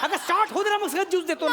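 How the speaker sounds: high, strained appeal; exasperated pleading. Acted. Director begging actress to wait, high exasperated plea. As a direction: plead with rising pitch.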